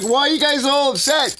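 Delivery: high mock-innocent question; retold protest. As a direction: act out protesting to upset cops.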